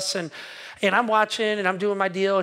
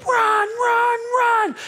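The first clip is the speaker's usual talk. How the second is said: much higher, louder urgent shout; a fleeing worker yelling at his boss to run, high and loud; retold yell; 14 semitones higher, 5 dB louder, much slower than usual. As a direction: yell run at a friend with a dog behind. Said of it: "he's yelling something".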